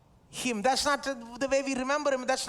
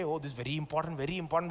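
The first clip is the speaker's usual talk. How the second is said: mock lip service; 7 semitones lower, 5 dB quieter, faster than usual. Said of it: "We'll say".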